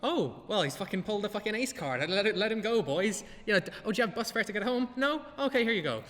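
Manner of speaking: mock police officer. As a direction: play the cop as a pushover, cheerfully. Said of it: "the police officer managed to go".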